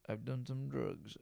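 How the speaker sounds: quiet aside. Shown as a demonstration. Admitting past drug use to his listeners, a hushed sly repeat. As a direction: drop it to a sly murmur. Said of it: "repeats it quieter".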